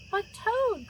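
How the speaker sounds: high plea for Toad; crying out. A narrator's reading. Frog crying out to Toad going back to bed. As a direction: call out after him. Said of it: "cried Frog".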